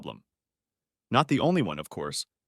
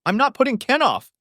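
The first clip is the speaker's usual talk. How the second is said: much higher, louder scornful retort; snorted; 7 dB louder than usual. A narrator's reading. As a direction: toss it off with contempt. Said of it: "Scylla snorted".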